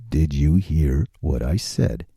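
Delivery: low slow mock-serious boom; deep slow repeat. Shown as a demonstration. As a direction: drop into a deep, slow ominous voice.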